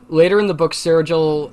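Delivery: normal talking voice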